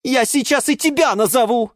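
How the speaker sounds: high-pitched threatening snap; angry bark. A narrator's reading. Geranium threatens the lemon, barking angrily. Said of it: "рявкнула на него герань Антонина".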